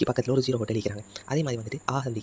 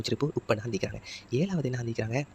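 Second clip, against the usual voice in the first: ordinary delivery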